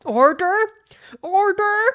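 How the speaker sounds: loud, high judge's call; calling for order. Acted. Judge to a noisy courtroom, insistent high shout.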